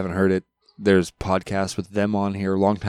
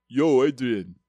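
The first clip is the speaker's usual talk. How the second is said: Rocky; 3 dB quieter, slower than usual. Retold or imitated. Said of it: "I have to imagine him as Rocky".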